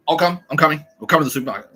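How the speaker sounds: high, loud mock eagerness; over-eager butting in. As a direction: blurt it out, imitating a pushy tagalong. Acting the intrusive housemate butting in.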